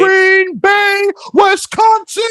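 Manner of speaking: high announcer shout. Pretend. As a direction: belt out the city name. Shouting team city.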